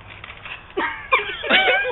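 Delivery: sudden high-pitched laugh. Real, not acted. Reader laughing out of the story at the narration. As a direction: break into a giggle.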